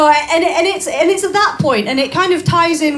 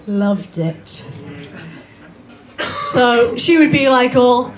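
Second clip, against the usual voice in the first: ordinary talk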